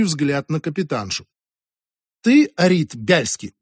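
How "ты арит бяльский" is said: slow, pointed question; a touch of impatience; slightly impatient. A narrator's reading. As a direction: press for confirmation with slight impatience. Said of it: "слегка нетерпеливо поинтересовалась та".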